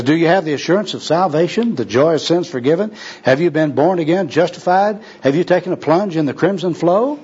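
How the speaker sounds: string of high, lilting pious questions; earnest evangelistic quizzing. Acted. Second worker quizzes bum on salvation, earnestly.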